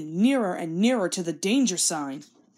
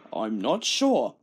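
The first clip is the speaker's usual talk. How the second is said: much lower, slow and doubtful; pondering Fat Controller; 8 semitones lower, slower than usual. Acted. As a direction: weigh it up gravely.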